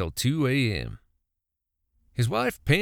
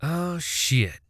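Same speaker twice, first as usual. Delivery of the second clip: exclaiming; 5 semitones higher than usual. A narrator's reading. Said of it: "Billy exclaimed".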